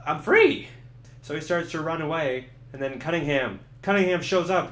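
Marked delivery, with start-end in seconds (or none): 0.1-0.7 much higher, louder cry of freedom; joyful shout. Acted. Murphy waking after the crash, shouting with joy. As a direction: yell it like a freed man. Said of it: "He's like".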